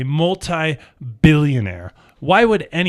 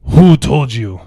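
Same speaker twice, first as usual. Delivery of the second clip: louder put-on thug voice; character voice; 6 dB louder than usual. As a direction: menace them in a put-on voice. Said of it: "I won't do the voice anymore".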